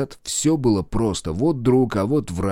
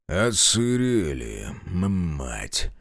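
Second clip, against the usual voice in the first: grumbling curse; 3 semitones lower, much slower than usual. Acted. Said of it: "выругался сторож".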